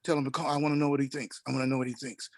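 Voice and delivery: soft, hushed imitation of a musician. Retelling a musician sending a message through his visitor, softly imitated. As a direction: keep it very quiet, relaying his message. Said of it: "And he says".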